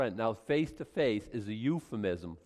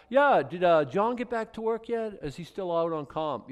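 casual boss; 6 semitones higher, 4 dB louder than usual. Retold or imitated. Boss replies casually in story.

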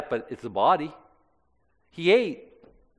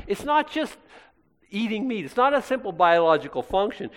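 much higher, insistent denial; emphatic rebuttal; 7 semitones higher than usual. Acted. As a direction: press the point home, rising high. Preacher rebutting the excuse.

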